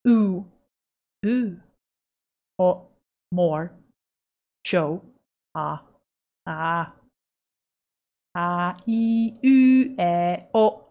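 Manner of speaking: exaggerated vowel shapes, one by one; testing the mouth tracking. Shown as a demonstration. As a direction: stretch the mouth on each vowel. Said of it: "It has trouble with oh".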